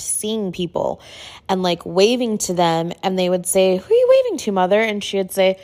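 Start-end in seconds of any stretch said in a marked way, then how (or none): 3.8-4.8 much higher, gently puzzled asking; high questioning voice. Retold or imitated. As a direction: ask a confused elderly mother. Aunt questioning grandma.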